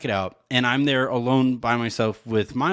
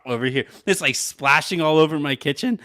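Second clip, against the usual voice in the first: normal talking voice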